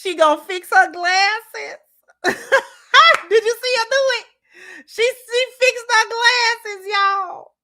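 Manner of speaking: high squeal through laughter; real giddy, high-pitched delight; tickled laughing. Real, not acted. Gleeful, laughing about the glasses.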